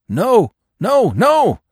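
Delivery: high, loud yelp of protest. A narrator's reading. Accused dog denying it to the fox, high yelping. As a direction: yelp it out in sharp high bursts. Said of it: "yelped the dog".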